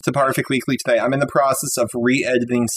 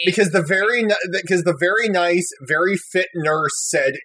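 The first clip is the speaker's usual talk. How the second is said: usual speaking voice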